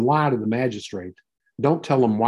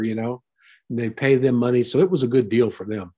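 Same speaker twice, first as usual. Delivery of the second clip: ordinary talk, nothing marked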